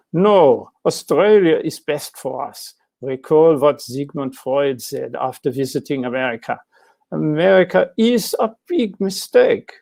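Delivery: a hint of a refined Viennese accent, firmly deciding; posh Viennese accent. Architect telling his wife they'll stay, in a mild posh Viennese accent. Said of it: "He spoke with an accent, but he sounded very posh".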